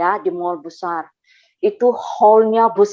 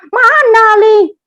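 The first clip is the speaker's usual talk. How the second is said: high, loud imitated scolding; angry retort; 11 semitones higher, 10 dB louder, slower than usual. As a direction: snap back loudly, challenging the friend. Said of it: "dia marah saya".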